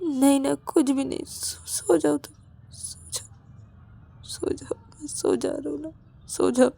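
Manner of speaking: high, faltering voice sinking into whisper; tearful denial. Acted. Ravi insists nothing is wrong, tearful and faltering. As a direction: stammer meekly through tears. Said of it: "तुम रो क्यों रहे हो?".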